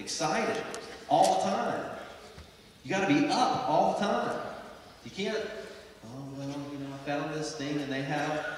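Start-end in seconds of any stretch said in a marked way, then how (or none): 5.4-8.5 mock half-hearted recruit. Mocks an unenthusiastic recruit.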